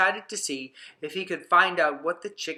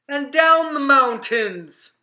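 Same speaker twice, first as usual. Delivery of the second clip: down the mountains higher, louder and slow; rising on down; 8 semitones higher, 7 dB louder, slower than usual. Acted. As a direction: swoop up on down. Contrast up and down.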